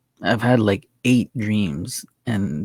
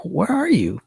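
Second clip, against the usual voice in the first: higher-pitched retold question; puzzled calling out; 8 semitones higher than usual. Dreamer asking the hidden singer's voice, puzzled and higher-pitched. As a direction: act out searching for someone, pitched up.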